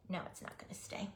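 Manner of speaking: fast resigned whisper; hushed quick grumble at the hat. Real, not acted. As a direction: mutter it under your breath quickly. Whispered to the glued piece.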